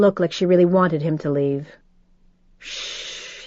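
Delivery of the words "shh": crooned whispered shh; comforting her in his arms; soft shushing. Acted. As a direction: hush her gently. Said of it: "he crooned".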